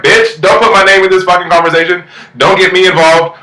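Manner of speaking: high-pitched mock-indignant telling-off; retold angry outburst. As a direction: act out her snapping back, pitched well up. Retold angry interjection in a quarrel.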